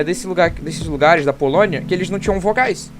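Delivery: normal talking voice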